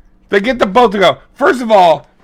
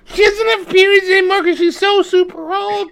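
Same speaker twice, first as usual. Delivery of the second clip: mock voice; 13 semitones higher than usual. Voicing Henry's mom bit.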